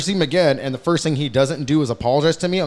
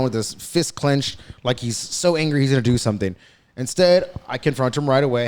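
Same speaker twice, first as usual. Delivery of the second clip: ordinary talk, nothing marked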